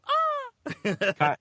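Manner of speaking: very high loud tiny scream; bubble scream. Pretend. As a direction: shriek as if bursting from a bubble. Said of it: "when that bubble pops, they hear".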